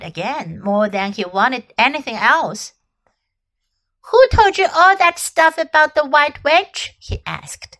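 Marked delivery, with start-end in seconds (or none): 4.1-6.9 higher, louder sulky question; boy. A narrator's reading. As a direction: challenge her story. Edmund, boy voice.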